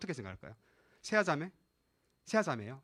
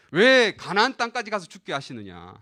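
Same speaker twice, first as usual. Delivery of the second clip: loud wail of protest, falling away; retold resentful complaint; 8 dB louder than usual. Israelites blaming God and Moses, retold loud complaint. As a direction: imitate a resentful crowd, loud on the opening. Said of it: "하나님을 원망하고 지도자를 원망합니다".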